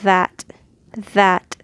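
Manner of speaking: that with a voiced th, tongue between teeth; voiced th demo. Shown as a demonstration. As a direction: stress the buzzing th in that.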